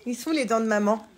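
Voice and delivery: loud, high playful teasing. Real, not acted. Mother playing teeth game with baby, loud and playful. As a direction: get a giggle out of her.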